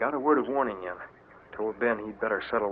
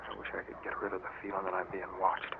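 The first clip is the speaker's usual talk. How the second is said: much softer, uneasy undertone; hushed worry; 2 semitones lower, 10 dB quieter than usual. Acted. Harvey to Blackjack in the dark, hushed uneasy worry. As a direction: confess the fear quietly. Sneaking in darkness.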